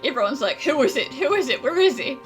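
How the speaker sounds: normal talking voice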